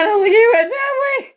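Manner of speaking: high shout pointing the way; calling out. Retold or imitated. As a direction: yell it to the paramedics across the room. Calling to paramedics.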